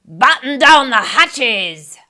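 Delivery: slow, loud storm order; booming pirate command; captain shout. Acted. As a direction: shout over the waves. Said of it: "Braidbeard shouted".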